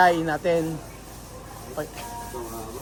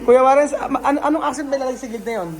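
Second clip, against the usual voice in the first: laughing; 7 semitones higher, 4 dB louder, faster than usual. Real, not acted. Laughing question to Kuya Warren.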